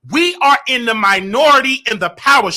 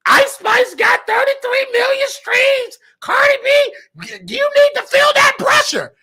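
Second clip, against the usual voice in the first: fired-up rant; 12 semitones higher than usual. Real, not acted. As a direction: whip the crowd up over the stream numbers. Ranting about streams, pitch jumps.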